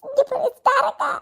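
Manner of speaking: sobbing and laughing at once, pitch pushed up; laughing through tears. Acted. Woman on the phone, crying and laughing as she denies crying. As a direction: insists she isn't crying while the sobs break through.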